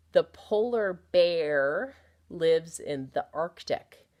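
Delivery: slow, deliberate model reading; slow read-aloud. Shown as a demonstration. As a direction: read it slowly for beginning readers. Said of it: "Let's read this next one".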